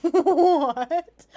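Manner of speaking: laughing, high and loud in disbelief; laughing what. Real, not acted. Cohost hears the bird conspiracy, laughing disbelief. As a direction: burst out laughing in shock.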